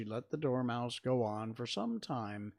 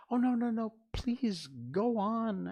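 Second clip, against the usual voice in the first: high, loud entreaty; very humble; 12 semitones higher, 7 dB louder than usual. A narrator's reading. Alice begging the Dormouse to continue, very humble. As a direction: beg urgently, drawing out the please. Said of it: "Alice said very humbly".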